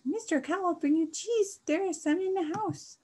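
very high, loud squeaky mouse; mouse squeak. A narrator's reading. Mister Mouse offers Mister Cow cheese, squeaking high. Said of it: "squeaked Mister Mouse".

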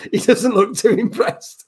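laughing through the words; giggling while speaking. Real, not acted. Laughing at friend's reaction.

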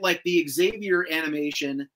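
normal talking voice

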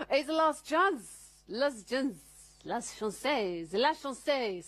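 badly faked French accent, high opening. Shown as a demonstration. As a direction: put on a hammy French accent. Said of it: "That was my French accent".

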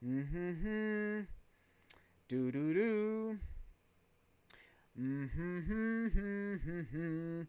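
soft playful humming; teasing hmm. Real, not acted. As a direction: hum quietly to yourself. Humming while reading chat.

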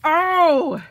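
very high, loud cry of joy; delighted shout. Real, not acted. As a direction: scream with glee at opening it.

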